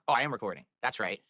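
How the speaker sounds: usual speaking voice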